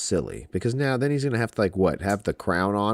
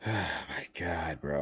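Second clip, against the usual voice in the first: breathless whispered oh my God; exasperated whisper; 4 semitones lower, 11 dB quieter than usual. Real, not acted. Fan whispering in despair over the match pick. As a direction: whisper it in disbelief, slowly. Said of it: "whispered exasperation".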